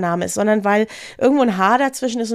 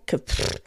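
imitated puff of air, no voice; stumped puff; 6 dB quieter than usual. Acting out writers with no name ideas, stumped puff.

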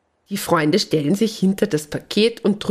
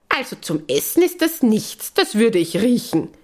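high squeaky critter voice; 5 semitones higher than usual. Acted. Animal friend voiced in story.

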